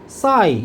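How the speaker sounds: "Sigh" for say, vowel opened to eye; Australian-style "Sigh" in place of say; Australian pronunciation of say. Shown as a demonstration. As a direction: stretch "Sigh" to rhyme with eye. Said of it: "이렇게 발음해버려".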